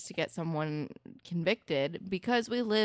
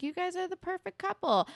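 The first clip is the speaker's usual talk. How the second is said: gushy, much higher pitch; mock-gush in a high voice; retold gushing praise; 13 semitones higher than usual. Quoting gushing praise of couple.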